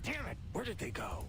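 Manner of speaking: high, frustrated outburst; angry. Acted. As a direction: curse at the missing files. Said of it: "he sounded so mad".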